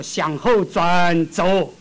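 very slow, drawn-out order; slow solemn command. Retold or imitated. God turning the sinner around, slow solemn command. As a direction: give God's command with weight.

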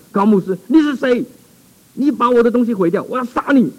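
high, fierce possessed threat; fierce demon voice. Retold or imitated. Possessed man threatens the pastor, fierce and high. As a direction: snarl out the spirit's death threat. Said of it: "很凶狠的看着我说".

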